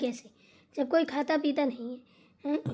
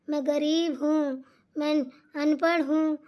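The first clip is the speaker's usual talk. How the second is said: ordinary delivery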